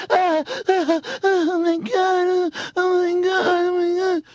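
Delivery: high, drawn-out whimpering panic; mock terrified wailing. Mocking people who think guns are banned, terrified wailing. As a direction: wail like a hammed-up hysterical coward. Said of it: "so they're just like".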